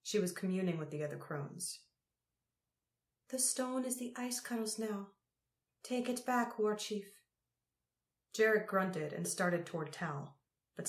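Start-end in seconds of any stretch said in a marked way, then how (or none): 3.3-7.1 higher-pitched, coolly detached crone; lightly voiced cool authority. A narrator's reading. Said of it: "her voice cool".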